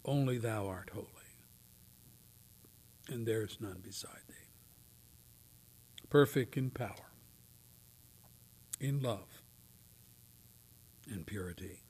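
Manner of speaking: whispered, reverent hymn line; hushed reverence. Acted. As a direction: breathe it in awe.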